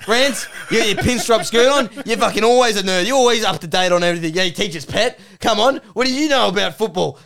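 aggressive, high and loud Aussie heckling; Duck. Acted. Duck taunts Rants as a nerd, loudly aggressive. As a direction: hound him with jeering questions. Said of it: "aggressive Australian male".

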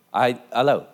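normal talking voice

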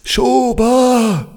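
high crowd shout of the surname. Pretend. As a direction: yell the surname like a fan. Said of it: "im Stadionsprechermanier".

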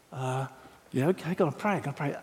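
soft, resigned agreement; sheepish quiet okay; reluctant mumble. Retold or imitated. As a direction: mumble it like a cowed convert. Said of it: "someone came to me and said".

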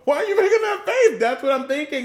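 high, loud laughing objection; laughing protest. Real, not acted. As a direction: protest loudly through laughter. Laughing protest at her face.

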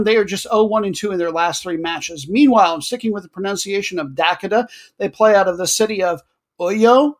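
ordinary delivery

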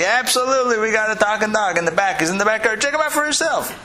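high-pitched, hammed-up homeowner voice; eager homeowner. Homeowner inviting a stranger to the backyard, high eager voice. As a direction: pitch it up like a keen seller.

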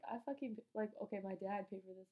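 usual speaking voice

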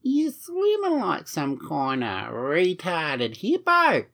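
hammed-up Aussie lifeguard, drawn out; Aussie accent. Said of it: "saying to you with an Aussie accent".

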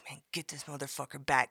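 unvoiced whisper of a voice in her head; whispered inner voice. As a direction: hiss it like a voice in her ear. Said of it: "Pierre's voice in my head".

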